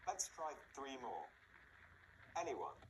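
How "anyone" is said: AN-yone, stressed at the beginning; first-syllable stress. Shown as a demonstration. Teacher modelling a word to repeat, first syllable stressed in Anyone. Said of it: "It can be at the beginning".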